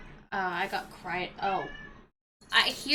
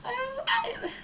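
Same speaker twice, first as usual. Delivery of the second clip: laughing. Real, not acted. Laughing at own story.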